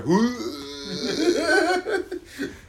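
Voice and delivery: high, long mock retch; drawn-out, high-pitched fake puking noise; imitated retching. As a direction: mimic someone hurling. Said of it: "just hearing him up there".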